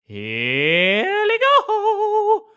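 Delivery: high, booming hype-man intro over music; grand entrance announcement. Acted. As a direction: announce her like a showman bringing on the star. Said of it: "that was quite an intro".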